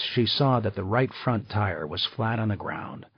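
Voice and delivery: usual speaking voice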